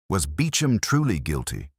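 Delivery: ordinary delivery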